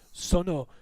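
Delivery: half-earnest yelled catchphrase; mock yell. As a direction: call it out as a tentative battle cry. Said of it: "So do you yell".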